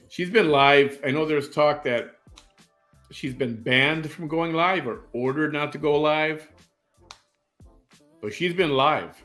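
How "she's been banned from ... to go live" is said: ordinary talk, nothing marked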